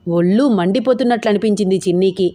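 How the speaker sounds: usual speaking voice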